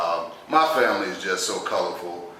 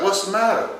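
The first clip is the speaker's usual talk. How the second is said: nurse; 10 semitones higher than usual. Retold or imitated. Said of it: "She's like".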